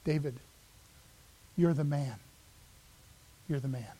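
soft, low parting shot; very quiet. Acted. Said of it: "he whispers".

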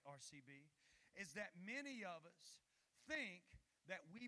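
building loud sermon voice; preaching. Acted. Pastor pressing the church on deals with God, building. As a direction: ramp up toward the point.

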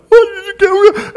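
high, loud panicked squeak; panicked squeal. Retold or imitated. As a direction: squeal it like a scared beginner. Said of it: "'cause I was going".

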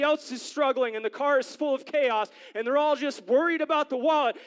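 high-pitched, emphatic sermon delivery; impassioned preaching. Real, not acted. Preacher exhorting congregation to trust God's faithfulness, impassioned preaching. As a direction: build phrase on phrase to exhort the crowd. Preaches the point at full force.

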